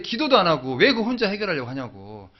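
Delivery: ordinary talk, nothing marked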